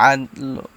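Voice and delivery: আদ লো with a light, short লো, not fully rounded; হালকা লো. Shown as a demonstration. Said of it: "একদম হালকা simple উচ্চারণটা হবে".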